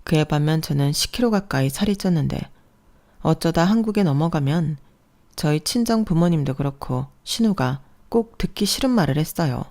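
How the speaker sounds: ordinary delivery, nothing marked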